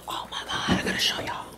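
hushed, secretive whisper; excited whisper. Real, not acted. Vlogger whispering to viewers about a package.

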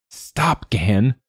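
high, strained plea to a friend; high choked protest; choking with mirth. A narrator's reading. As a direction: beg him to stop, voice pitched right up. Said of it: "Quoth choked".

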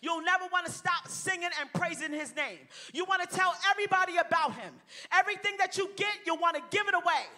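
high, loud, fervent preaching to the crowd; full-force preaching. Acted. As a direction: build the fire and drive it home.

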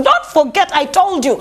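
ordinary delivery

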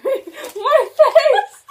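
laughing through a stuffed mouth; cracking up, cheeks full. Real, not acted.

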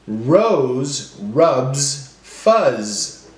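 very slow, buzzing out every final z; very slow, stretched sentence. Shown as a demonstration. Teacher modelling end sounds very slowly to class. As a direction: drag each word out to hear the z. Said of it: "every word's gonna end with the same sound".

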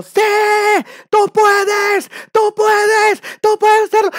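very high, loud hammed-up cheering; mock motivational shout. Coach shouting motivation at the crowd, mock shout. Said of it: "gente que te está gritando".

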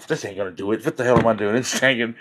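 muttering soda can. Retold or imitated. Voicing a failing soda can.

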